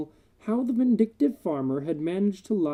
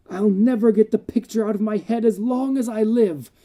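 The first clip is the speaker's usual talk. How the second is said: raised, urgent voice of a horrified doctor; agitated doctor; 5 semitones higher, 4 dB louder than usual. A narrator's reading. Doctor hurls his horror at the wounded undertaker, raised and urgent. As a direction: pour out the horror in a tense rush. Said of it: "a succession of shuddering whispers".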